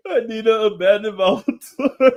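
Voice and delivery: high and loud, half laughing; laughing through words. Real, not acted. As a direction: push it out while cracking up. Laughing while imitating [name].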